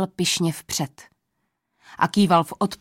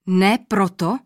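startled, questioning cry; a touch of disbelief; surprised exclamation; 3 dB louder, slower than usual. A narrator's reading. King surprised by the old man's answer, exclaiming. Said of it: "zvolal překvapeně král".

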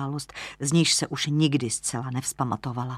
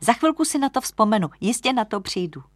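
quick, higher, determined lodger; eager insistence; 7 semitones higher than usual. Acted. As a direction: insist eagerly that it will come back. Lodger straining to remember a name. (dychtivě, rychle a výš)

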